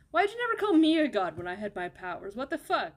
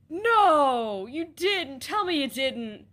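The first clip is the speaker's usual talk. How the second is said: loud, higher horrified denial; horrified shouting; 4 semitones higher, 7 dB louder, much slower than usual. Acted. Villain learns the list was used, horrified shouting. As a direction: shout it in dawning horror.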